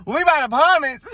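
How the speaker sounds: high, hammed-up Atlanta voice; Atlanta accent. Shown as a demonstration. Showing off her ATL to viewers, hammed-up Atlanta accent. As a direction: ham up the ATL sound, laughing at it. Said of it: "I'm about to sound A T L".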